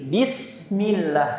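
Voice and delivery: "Bismillah" with thin bright a, lips spread in a smile; smiling fatha. Shown as a demonstration. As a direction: smile through "Bismillah" slowly. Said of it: "Senyum, meringis".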